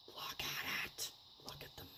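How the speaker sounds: hushed, fading whisper; awed whisper. Real, not acted. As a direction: whisper in admiration. Awed admiration of the paper.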